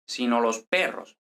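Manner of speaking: "sino" run lightly into "los", first accent on PERROS; unstressed sino. Shown as a demonstration. Teacher showing the conjunction is unstressed, accent waits for PERROS. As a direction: glide over "sino los" and stress PERROS. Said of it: "El acento no viene hasta sino los perros".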